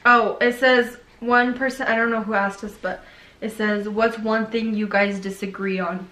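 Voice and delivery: normal talking voice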